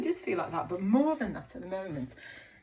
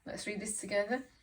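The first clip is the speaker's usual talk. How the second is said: plain reading voice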